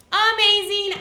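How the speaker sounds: loud, high burst of praise; big teacher cheer; excited praise. Real, not acted.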